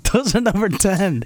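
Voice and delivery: laughing protest. Real, not acted. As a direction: laugh while defending yourself. Laughing defence of his ranking.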